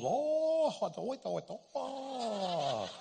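startled, stumbling over the words, trailing off laughing; surprised stammer. Retold or imitated. Waiter realizing his mistake, startled stammer. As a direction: caught off guard, can't finish the phrase. Waiter reacts to spicy chicken.

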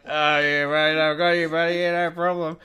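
loud, high imitation of a drunken bloke; drunk old man. Old drunk reassuring his mate at the urinal, slurred imitation. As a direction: play the tipsy old helper, pushed up high. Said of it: "this guy's seventy-year-old voice".

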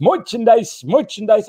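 high, comic put-on voice; hammed-up plug voice. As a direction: ham up the shop plug in a high character voice.

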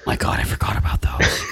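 breathless whisper of disbelief. Real, not acted. Stunned by Hobbit Grand Slams.

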